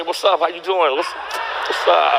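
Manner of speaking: low, slow flirty greeting; flirty nurses. Retold or imitated. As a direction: purr a greeting at the medic. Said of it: "the nurses say".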